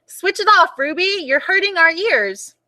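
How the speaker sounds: high mock shout of annoyed kids; children shouting. The other children shout at Ruby, high mock shout. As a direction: yell it back at her.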